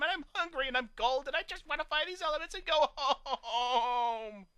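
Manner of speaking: high, slow, whining plea; whiny. A narrator's reading. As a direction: whine like a spoiled pony. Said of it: "Fashion Face whined".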